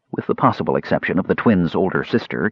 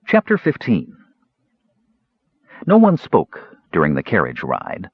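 ordinary delivery, nothing marked

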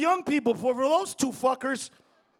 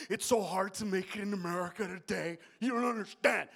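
mock whining; 6 semitones lower, 7 dB quieter than usual. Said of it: "bitching and moaning".

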